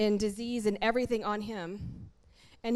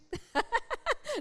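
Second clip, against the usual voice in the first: high-pitched chuckle; laugh; 11 semitones higher than usual. Real, not acted. Preacher joking about being fried up, laughing. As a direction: laugh lightly at your own joke.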